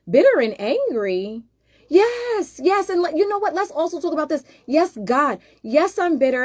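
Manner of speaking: high, loud exasperated admission; indignant outburst. Real, not acted. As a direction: throw their words back at them, fired up. Indignant reply to critics.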